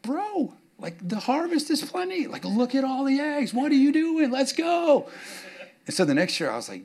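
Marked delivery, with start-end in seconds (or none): 0.0-5.1 high, pleading dad to slow son; exasperated dad. Retold or imitated. Dad urges his calm son to hurry, high mock whine. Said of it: "I'm like".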